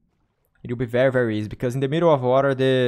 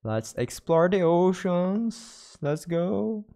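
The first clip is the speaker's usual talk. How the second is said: playful cheer; 7 semitones higher, much slower than usual. Pretend. Cheerful announcer-like exclamation.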